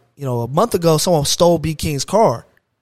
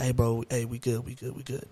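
low, soft, trailing reassurance; imitating calm friend; 4 semitones lower, 12 dB quieter than usual. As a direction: play the unbothered friend quietly settling everyone down.

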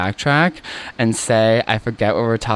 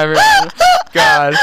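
exasperated squeal; 5 dB louder than usual. Real, not acted. Exasperated intro of sister.

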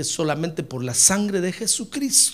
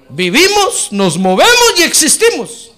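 high, loud proclaimed verse; preaching at full force; 9 semitones higher, 10 dB louder than usual. Real, not acted. Preacher proclaiming Paul's words to the church, loudly. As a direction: ring out each word.

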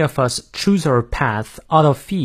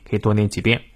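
ordinary talk, nothing marked

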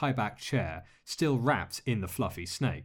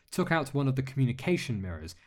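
usual speaking voice

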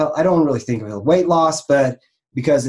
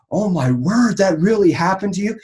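mock amazement; 7 semitones higher, 3 dB louder than usual. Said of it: "you're like".

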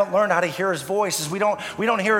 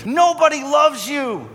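the devil shouting lies; 3 semitones higher than usual. Acted. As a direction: hurl the lies like the enemy. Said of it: "shouts his lies at us".

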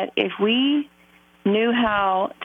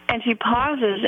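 ordinary talk, nothing marked

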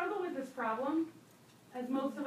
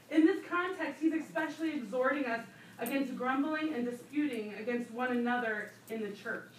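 ordinary talk, nothing marked

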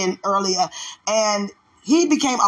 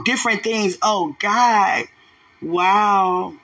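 ordinary delivery

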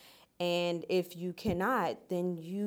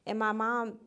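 ordinary talk

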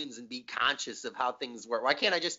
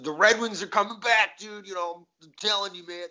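higher, louder fan boast; sports radio caller; 7 semitones higher, 4 dB louder than usual. Retold or imitated. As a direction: swear it like a loyal fan. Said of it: "calls about the Lions and be like".

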